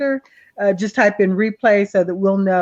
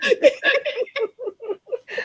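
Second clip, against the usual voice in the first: laughing; 4 dB quieter than usual. Real, not acted. Laughing at her own yawning.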